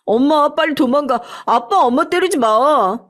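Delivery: put-on a little kid begging dad to stop hitting mom; frightened child. A narrator's reading. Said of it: "소리를 지르기 시작했어요". (아빠를 말리는 아이, 높은 목소리로 외침)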